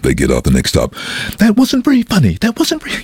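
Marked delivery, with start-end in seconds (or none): 1.4-3.0 prim disapproving lady voice. Retold or imitated. Disapproving ladies quoted.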